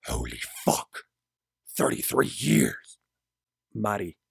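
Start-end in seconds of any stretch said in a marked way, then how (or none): 0.0-3.0 stunned Gabriel. A narrator's reading. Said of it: "Gabriel then says".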